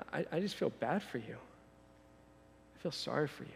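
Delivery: soft, pitying condescension; condescending pity. Acted. Jesus looking on the comfortable rich, quiet condescending pity. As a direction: feel sorry for them, very quietly. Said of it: "he's much more condescending than that".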